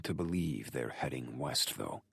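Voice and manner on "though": ordinary delivery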